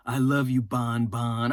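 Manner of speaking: low mock-lovesick Frank cooing Bon Bon. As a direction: coo the pet name, lovesick. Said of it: "Fine Ass Frank has to go up to her".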